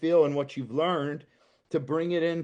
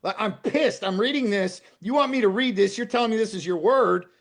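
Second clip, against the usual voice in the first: raised, higher voice railing at God; angry venting; 6 semitones higher, 4 dB louder than usual. Retold or imitated. Man venting his anger at God in honest prayer, raised and heated. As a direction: let the frustration out loud.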